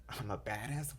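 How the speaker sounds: incredulous replay of your own words, rising at the end; mock self-reproach. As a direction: imitate someone cringing at what they said. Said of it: "beat yourself up after".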